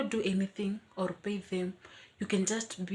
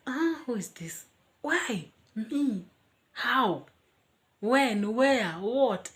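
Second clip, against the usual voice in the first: bewildered questions; 4 semitones higher, 5 dB louder, much slower than usual. Retold or imitated. Questions racing in her mind.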